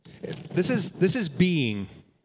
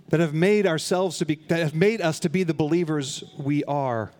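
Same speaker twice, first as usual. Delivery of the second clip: ordinary delivery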